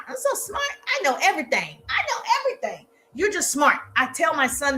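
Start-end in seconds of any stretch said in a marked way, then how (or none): 0.1-2.9 high mock smug voice, ending in a laugh; sarcastic Mama Voice, pitched up; mocking know-it-all. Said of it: "I do my voice like this all the time".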